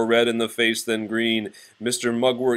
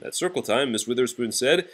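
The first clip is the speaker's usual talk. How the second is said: usual speaking voice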